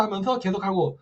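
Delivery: ordinary delivery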